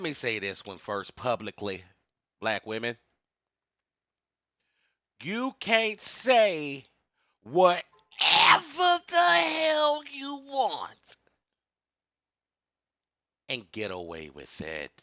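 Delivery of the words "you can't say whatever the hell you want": high, slow, shouted preaching; booming rant. Real, not acted. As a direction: drag each word out and shout it.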